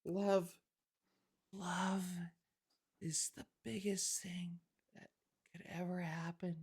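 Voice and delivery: whispered, halting mock-romantic monologue; mock breathy lovestruck speech. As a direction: whisper it with long pauses, parodying a lovesick heroine. Mocking a film character's love speech.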